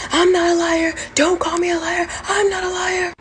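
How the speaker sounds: high-pitched mimicked yell, insistent; high, strained imitation of someone yelling in protest; imitated yelling. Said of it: "starts yelling at me to the top of his lungs".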